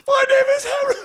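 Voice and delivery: put-on very high, loud trembling child voice; squeaky scared kid. Little boy introducing himself to the bully, squeaky. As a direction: squeak it out bravely but terrified.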